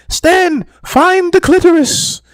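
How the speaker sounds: high, mock-solemn movie voice; a lightly voiced movie deity; movie quote voice. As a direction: proclaim it like the film's god. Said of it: "the theme throughout the movie".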